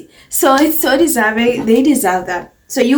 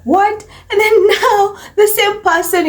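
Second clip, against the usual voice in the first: laughing; 9 semitones higher than usual. Real, not acted. Laughing disbelief at UK media.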